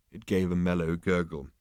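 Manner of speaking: ordinary delivery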